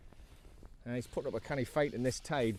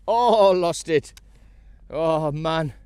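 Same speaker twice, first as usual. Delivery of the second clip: higher, louder, slow groan as the fish escapes; loud dismayed cry; 5 semitones higher, 11 dB louder, much slower than usual. Real, not acted. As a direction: drag out the disappointment loudly. Fish gets away on the line.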